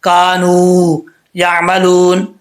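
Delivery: कानू and यामलून with the वाव stretched long; long madd. Shown as a demonstration. Teacher shows the stretched वाव, slow. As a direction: hold the long ऊ. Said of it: "जैसे लू".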